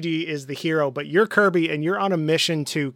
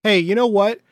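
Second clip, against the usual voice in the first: louder, higher, emphatic scolding; fed-up King Dedede; 5 semitones higher, 8 dB louder than usual. Acted. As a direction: lay down the law, raised voice. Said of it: "D D D is like".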